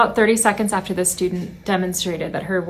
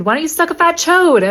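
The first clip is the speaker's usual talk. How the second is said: high, loud bratty comeback; imitating a kid yelling back; mock yelled retort; 9 semitones higher, 7 dB louder than usual. Student yells back at teacher.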